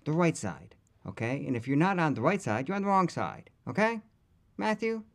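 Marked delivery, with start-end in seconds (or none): 1.5-5.0 worked-up rant. Real, not acted. Ranting at McConaughey.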